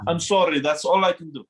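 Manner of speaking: lightly put-on accented film-star voice; a rough attempt at a famous actor's accent; hammed-up accented apology, dropping in pitch; Connery. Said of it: "Connery voice".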